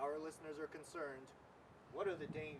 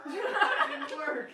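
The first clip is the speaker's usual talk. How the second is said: slow, high, loud, laughing through it; 13 semitones higher, 15 dB louder, much slower than usual. Real, not acted. Anchor cracking up at a failed bit, laughing. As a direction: giggle the words out.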